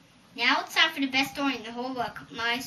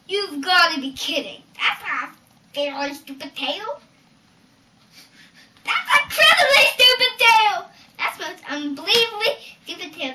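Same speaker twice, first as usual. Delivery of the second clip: rising from louder to a much higher shout; building outrage; 6 semitones higher, 10 dB louder than usual. Acted. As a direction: climb into a furious shout. Jack rants at giant's story.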